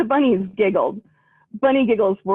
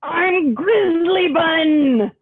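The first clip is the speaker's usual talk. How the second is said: high, very slow mock roar; roaring Grizzly Bun; 7 semitones higher, much slower than usual. Grizzly Bun introducing herself, a hammed-up roar. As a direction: stretch it out like a proud little roar. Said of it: "she roared".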